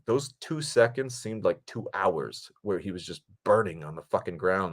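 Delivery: ordinary delivery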